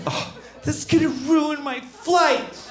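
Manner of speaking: hammed-up self-pity; whiny passenger. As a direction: moan like a spoiled flyer. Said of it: "You're like".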